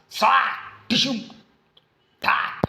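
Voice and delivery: high voiced punch noises; fight sound effects. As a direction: mouth the whoosh and punch sounds.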